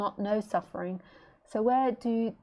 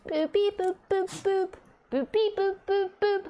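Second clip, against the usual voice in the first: high beep-boop chirps; bird beeping; 10 semitones higher than usual. Retold or imitated. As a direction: mimic the bird's beeping, high up. Imitating the bird's sounds.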